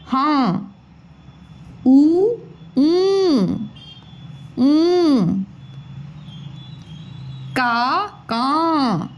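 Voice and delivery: each letter said slowly on its own with long pauses; teacher drilling letters; syllable drill. Shown as a demonstration. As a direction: call out letters one by one for kids to repeat. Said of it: "तो साथ में मेरे बोलिए".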